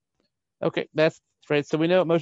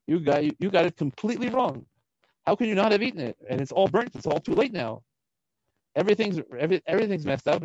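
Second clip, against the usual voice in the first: exasperated, high-pitched scolding; angry tirade; 6 semitones higher than usual. Acted. Moshe berating Aaron's sons for the burnt offering, angry tirade. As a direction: pile up the accusations in a higher pitch. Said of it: "now this is a tirade".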